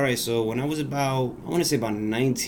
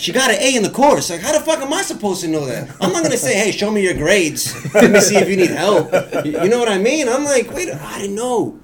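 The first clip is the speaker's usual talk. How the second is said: high, loud, laughing outrage; exasperated; 10 semitones higher, 9 dB louder than usual. Real, not acted. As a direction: throw up your hands, incredulous.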